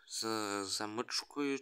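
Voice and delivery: normal talking voice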